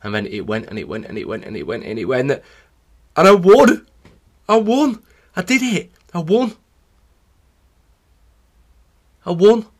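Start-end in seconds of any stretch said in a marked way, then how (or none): 2.3-9.7 high, loud disbelieving victory cries; elated, pitched way up, with pauses; reliving triumph. Retold or imitated. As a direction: call it out as if just crossing the line a winner. Reliving the moment of winning.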